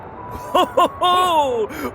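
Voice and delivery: high gleeful cackle. Acted. Balder teasing during game.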